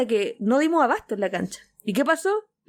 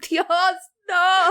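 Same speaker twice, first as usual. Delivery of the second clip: high, loud cry of disbelief; overwhelmed cry; 8 semitones higher, 3 dB louder than usual. Retold or imitated. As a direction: squeal it like a fan losing it. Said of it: "así como".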